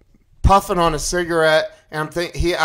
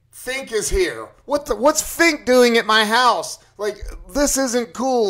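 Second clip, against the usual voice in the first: higher-pitched, agitated retold protest; acted freak-out; 7 semitones higher, 3 dB louder than usual. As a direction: replay the alarm with pitch raised. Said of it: "I'm freaking out".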